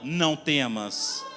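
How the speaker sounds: low, drawn-out solemn commands; Spirit-filled prophetic declaration. Acted. Servant of God speaking in the Spirit's power, prophetic declaration. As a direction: pronounce each command slowly with authority. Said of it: "cheia do poder do Espírito Santo e falando".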